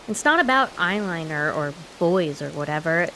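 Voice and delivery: ordinary talk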